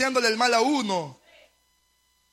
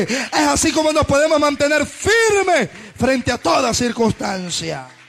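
loud, driving sermon delivery; impassioned preacher in full flow; full-force preaching; 6 dB louder than usual. Real, not acted. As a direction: preach it hard, phrase after phrase, building to a long final cry.

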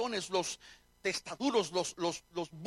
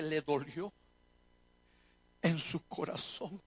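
solemn preaching; 3 semitones lower than usual. Real, not acted. Pastor telling congregation of God's grieved heart, solemn preaching. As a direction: let each grave phrase hang.

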